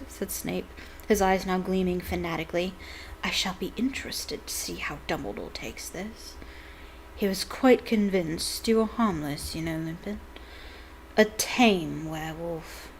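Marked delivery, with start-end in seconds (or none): 3.2-12.9 breathy, sneering whisper; gloating whisper. Acted. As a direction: hiss the threat under your breath. Snape gloating softly.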